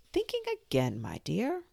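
a hint of a preachy Duchess. A narrator's reading. Duchess teasing Alice.

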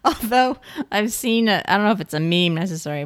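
usual speaking voice

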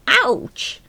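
high, loud yelp; cry of pain. Acted. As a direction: yelp from a sudden bump. Bumps head under desk.

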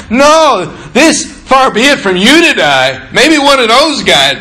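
high, emphatic objection; protesting Peter. Acted. Peter refusing to let Jesus die, protesting.

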